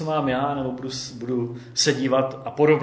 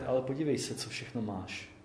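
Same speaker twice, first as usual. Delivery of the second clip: imitating a soft inner voice, trailing softer; softly voiced quiet reminder; gentle, quiet voice; 8 dB quieter than usual. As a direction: quote it gently and quietly, dropping away at the end. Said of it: "tím tichým hlasem".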